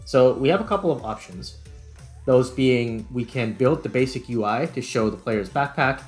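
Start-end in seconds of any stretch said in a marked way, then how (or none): none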